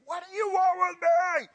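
high, loud screaming cry; demon's scream. Acted. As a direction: scream it like a possessed man. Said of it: "Imagine him screaming".

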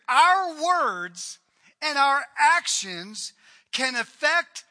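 loud, high, drawn-out sermon delivery; forceful preacher's crescendo, slow and emphatic; impassioned preaching. Acted. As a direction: drive each phrase home to the congregation at full force.

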